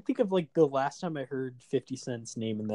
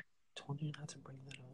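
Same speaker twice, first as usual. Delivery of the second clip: quick, secretive whisper; mock-private hissed aside; whispered scolding; 16 dB quieter, faster than usual.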